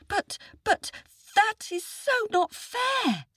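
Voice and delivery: very high, stammered start; protesting little boy. A narrator's reading. Boy protests to the tiger, high-pitched indignation. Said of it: "said the boy".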